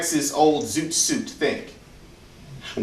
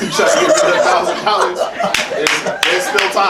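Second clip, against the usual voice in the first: laughing; 3 semitones higher, 3 dB louder than usual. Real, not acted. Joking request to audience.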